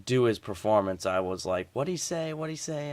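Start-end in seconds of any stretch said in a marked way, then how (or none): 1.7-2.9 quiet puzzled mutter; muttering to self. Retold or imitated. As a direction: mutter it under your breath, confused. Confused viewer muttering to self.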